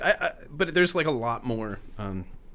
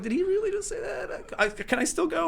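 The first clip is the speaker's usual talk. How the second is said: stunned disbelief. Retold or imitated.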